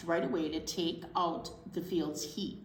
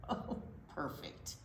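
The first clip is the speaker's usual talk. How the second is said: whisper; 5 semitones lower, 10 dB quieter than usual. Acted. Character tastes bean, reading aloud.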